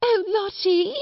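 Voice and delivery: high, frantic cry over the wailing; screamed plea. A narrator's reading. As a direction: call out in high-pitched desperation. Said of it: "screamed Miss Amelia".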